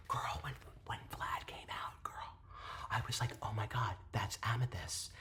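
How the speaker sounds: hushed awed whisper. Real, not acted. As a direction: breathe it out like a secret. Telling starstruck moment in whisper.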